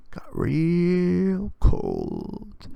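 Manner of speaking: very slow, ominous hush; spooky storyteller. A narrator's reading. Spooky turn in the Santa story, slow and hushed. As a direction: draw it out to spook them.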